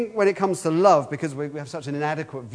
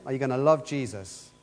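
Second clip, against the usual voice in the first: ordinary talk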